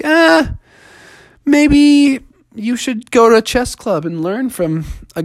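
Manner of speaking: offhand little bear advice. Acted.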